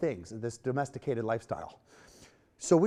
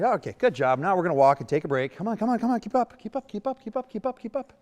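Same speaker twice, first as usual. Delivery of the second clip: bright, higher coaxing to keep walking; cheerful higher call to keep moving; upbeat urging; 8 semitones higher, 5 dB louder than usual. Shown as a demonstration. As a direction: cheer the horse along, higher and brisk.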